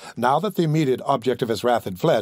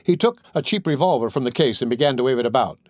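usual speaking voice

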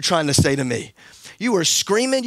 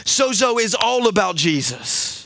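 preaching at full force; 7 semitones higher than usual. Acted. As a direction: preach it out, rising over the band.

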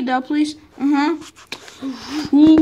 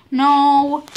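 normal talking voice

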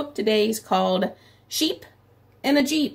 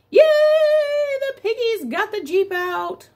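very high, slow, cheerful yay; cheering; 12 semitones higher, 3 dB louder, slower than usual. A narrator's reading. Teacher cheering the piggies for children, high and excited. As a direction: cheer like the kids just won. Celebrating rescue.

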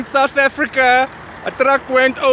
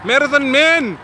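ordinary talk

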